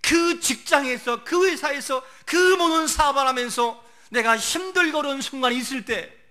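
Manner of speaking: loud, high, driving sermon delivery; full-force preaching. Real, not acted. Preacher on hard moments at work, preaching at full force.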